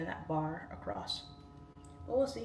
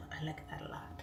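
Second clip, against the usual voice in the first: whispered; 3 semitones lower, 9 dB quieter than usual. Retold or imitated. Imitating fans, trailing whisper.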